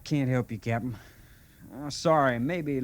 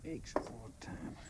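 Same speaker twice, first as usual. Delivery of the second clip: pained murmur; 2 semitones higher, 16 dB quieter than usual. Acted. Injured private alone, head aching.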